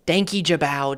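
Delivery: British-style vowel on the end of "Danky Jabbo"; British attempt. Shown as a demonstration. YouTuber testing how to say an unfamiliar name, tentative British pronunciation. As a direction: try "Danky Jabbo" British, last syllable like "bow". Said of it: "it sounds too British for me".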